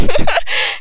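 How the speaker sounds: high giggle fit; burst of laughter. Real, not acted. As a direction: crack up laughing. Reader breaks up laughing.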